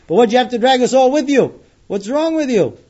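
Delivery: loud, high-pitched accusing shout; retold angry yell. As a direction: yell the blame at Binyamin. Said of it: "yell at him".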